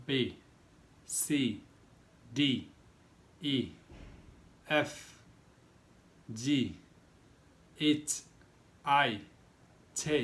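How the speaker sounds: slow, evenly spaced letter recitation; teacher reading the alphabet aloud; reciting the alphabet. Shown as a demonstration. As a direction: read each letter out clearly for learners. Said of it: "alphabet আমি আপনাদেরকে পড়ে শোনাবো".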